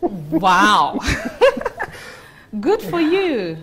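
loud, high laughing reaction; big amused whoop of congratulation. Acted. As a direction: burst out laughing at the news, then congratulate. Laughs at colleague's marriage news.

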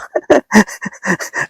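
a short high laugh at his own joke; real chuckle. Real, not acted. Preacher laughing at his own funeral story, short chuckle. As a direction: let a light laugh slip out.

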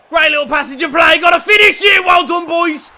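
high, loud and fast thrilled shouting; excited shout. Real, not acted. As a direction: rave about the move at full voice. Fan celebrating a move.

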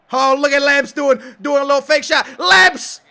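high, loud marvelling; excited cheering. Real, not acted. Gamer hypes Lamps's fake shot, excited cheering. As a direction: build to a shout on the player's name.

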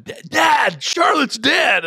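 put-on high, loud, drawn-out kid's shout; imitating kid. Father imitates second daughter shouting the pet died, high and loud. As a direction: yell the fake news like an excited child. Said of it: "Evelyn just hits me again".

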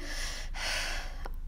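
long unvoiced tired sigh; breathy sigh. Letter G arriving out of breath with a sigh. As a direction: puff out all out of breath.

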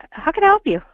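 high, far-off service voice; chirpy scripted phone agent; operator voice. Acted. Fake operator voice.

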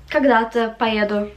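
ordinary talk, nothing marked

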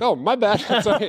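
sorry, laughing it off; high embarrassed laugh through the words; laughing apology. Retold or imitated. To a stranger owner, an embarrassed laughing apology.

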